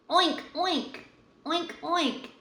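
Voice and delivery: squeaky high oink oink; high piggy oinks. Acted. Grandma oinks high for her grandson. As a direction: oink like a little piglet, up high.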